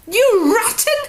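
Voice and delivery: put-on a girl shrieking at her sister for lying; shrieked. A narrator's reading. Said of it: "Ira shrieked".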